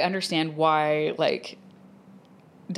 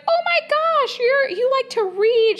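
put-on much higher, louder gushing older woman, slow on the read line; chatty flight attendant; 5 dB louder, slower than usual. Flight attendant gushing at a passenger, high and loud. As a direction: gush at her like an overexcited stranger. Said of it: "And she's like".

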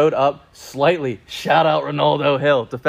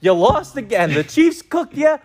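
mock taunt; 6 semitones higher than usual. Real, not acted. Taunting the coach on air.

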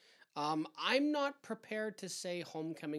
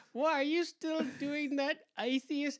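high, slow South African mother; mock South African accent, pitched way up; 12 semitones higher, 4 dB louder, slower than usual. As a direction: imitate a disapproving South African mom. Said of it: "fairly thick accent".

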